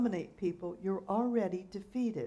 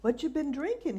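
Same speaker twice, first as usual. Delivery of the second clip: put-on higher, interested old man; curious old man; 7 semitones higher, 3 dB louder than usual. A narrator's reading. Old man asking the drunk, higher curious voice. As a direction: ask with sparkling curiosity. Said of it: "his eyes sparkling with interest".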